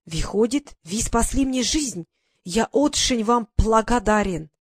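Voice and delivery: put-on slow, careful thanks in a foreign accent; a foreigner thanking his rescuer in broken Russian; grateful broken Russian. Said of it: "Он говорил на ломаном русском".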